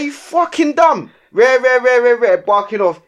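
yelling at the driver who parked on the crossing; retold angry yell. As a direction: blast him, drawing each word out.

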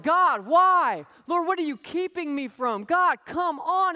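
high, loud pleading protest; whiny complaint to God. Retold or imitated. A believer whining at God about the boundaries, loud and high.

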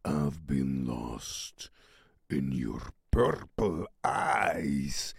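low, slow, drawn-out intoning; deep, deliberate recital of song lines; dramatic lyric recital. Acted.

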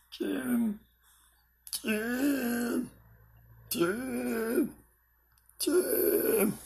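high whispered call. Pretend. Calling Jim in odd voice.

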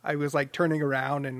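normal talking voice

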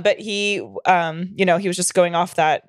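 ordinary delivery

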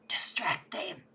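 soft whispered order. Pretend. Cat sneaking off while the guards are busy, whisper. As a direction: breathe the order to your friend. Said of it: "be like to Milo".

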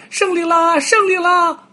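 very high, loud victory cry; monkey cheering. Acted. Monkey grabs the fruit and cheers, high and loud. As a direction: squeal it out like a jumping, thrilled monkey. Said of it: "喊着".

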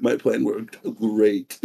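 sly gloat. Retold or imitated. Kid gloating quietly.

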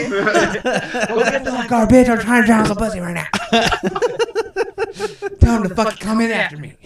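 hammed-up guy brushing her off; mock cocky guy. Imitating the guy, mocking.

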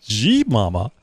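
slow, exaggerated imitation of a website plug; mock advertisement voice. Co-host teasing guest about her website plug, mock advertisement voice. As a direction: mimic her ad voice, drawing out each word. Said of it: "time for advertisement".

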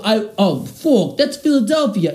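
high, hammed-up Boston accent. Shown as a demonstration. Host showing off about sports teams, high mock Boston accent. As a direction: push a badly faked Boston accent up high. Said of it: "how about my Boston accent".

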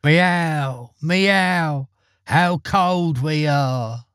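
high, long-drawn meowing wail; mournful cat cry. A narrator's reading. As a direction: draw it out slowly like mournful cats. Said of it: "a mournful cry echoed from the corner".

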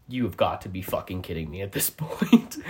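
low, quiet deadpan that breaks into a laugh; mock exasperated disbelief. Homeowner hears of a break-in, retold low exasperated disbelief. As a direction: imitate weary disbelief, dropping low and soft.

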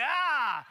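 high, loud mock scream of the gutted wolf. Dad telling a bedtime story to the kids, hammed-up wolf scream. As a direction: shriek like the wolf being knifed. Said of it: "it's like".

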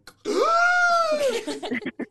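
very loud, high, long hiccup shriek; mom's crazy hiccup. Retold or imitated. Mom hiccuping in the kitchen, huge shriek. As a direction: imitate a huge hiccup whooping out. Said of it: "hear my mom in the kitchen go".

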